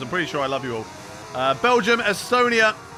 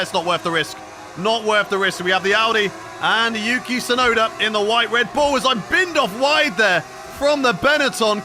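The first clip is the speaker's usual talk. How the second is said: loud, raised-pitch play-by-play; live race commentary; 5 semitones higher, 4 dB louder than usual. Acted. Streamer commentating his own race start, excited play-by-play. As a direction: call the race with rising excitement.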